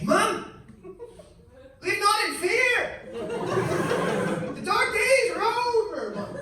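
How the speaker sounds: high, ringing, triumphant cry; grandly overblown, high-pitched announcement; hammed-up proclamation. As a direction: proclaim liberation to mom like a prophet. Acted proclamation to mom on phone.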